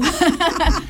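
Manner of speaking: short high laugh; a quick proud laugh; real laugh. Real, not acted. Proud of being the first grandmother graduate, short laugh.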